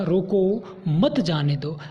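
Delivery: "रोको" held, break, then "मत जाने दो" together; pause after रोको. Shown as a demonstration. Teacher repeating the comma example, pause after "रोको". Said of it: "यदि अर्ध विराम लगा".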